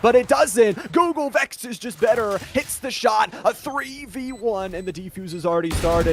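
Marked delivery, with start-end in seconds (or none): 0.0-4.8 excited. Real, not acted. Big play call.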